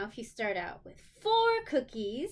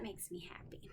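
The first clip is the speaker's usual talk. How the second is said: whisper; 3 semitones lower, 12 dB quieter than usual. Real, not acted. Teacher aside to children.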